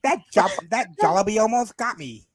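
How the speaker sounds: hammed-up, high Australian accent. Shown as a demonstration. Showing off Australian accent to laughing friends, hammed-up Australian accent. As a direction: play an over-the-top Australian in alarm. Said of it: "do a better Australian accent".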